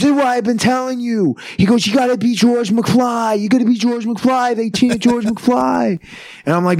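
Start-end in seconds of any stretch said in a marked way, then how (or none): 0.0-1.3 high-pitched mock exclamation; high, excitable put-on voice; insistent promoter. 1.8-6.0 high-pitched mock exclamation; high, excitable put-on voice; insistent promoter.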